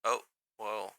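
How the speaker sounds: ordinary talk, nothing marked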